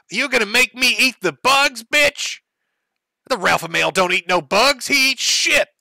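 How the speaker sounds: high, loud mocking voice of the angry streamer; mock Ralph rant. Commentator parodying the streamer, high mocking shriek. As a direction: parody him shrieking about bugs. Narrator mocks Ralph quoting him.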